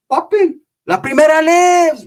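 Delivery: high, drawn-out battle cry; mock gung-ho shout; hype cry. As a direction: holler it up high like rallying the crew. Hype cry before the fight.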